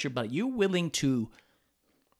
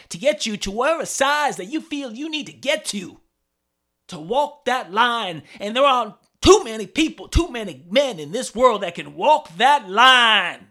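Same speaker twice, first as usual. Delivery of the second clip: loud, high wrestling-heel promo shout; wrestler promo voice; 6 semitones higher, 9 dB louder than usual. Pretend. Comic doing his wrestler character, shouting a promo. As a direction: roar it like a pro wrestler cutting a promo. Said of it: "that's my character, man".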